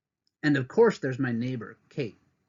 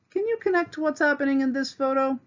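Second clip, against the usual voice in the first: much higher, bright question; playful prompt; 12 semitones higher, faster than usual. Real, not acted. Teacher quizzing young viewers, playful.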